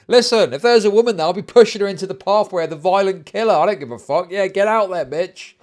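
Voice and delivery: high, loud hammed-up tirade; mock shameless rant. Streamer playing the man hiding behind his girlfriend, loud mock rant. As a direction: yell it as a mocking parody of bravado.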